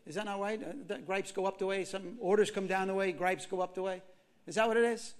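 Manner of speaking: ordinary delivery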